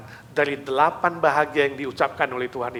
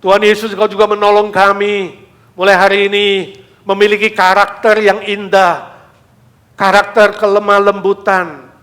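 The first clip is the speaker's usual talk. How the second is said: earnest prayer, swelling louder at Tuhan Yesus; fervent prayer; 9 dB louder than usual. Acted. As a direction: lead the church in prayer. Leads closing prayer.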